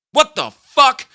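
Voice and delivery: high, loud shocked yell imitating grandpa; grandpa's shout. Grandpa walks in and looks at him, imitated shocked shout. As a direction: blast it out like grandpa catching you. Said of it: "my grandpa just walks in".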